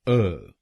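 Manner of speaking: अ said as a short neutral vowel; syllable demo. Shown as a demonstration. Teacher models the weak fourth syllable: isolated syllable. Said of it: "हम बोलेंगे अ".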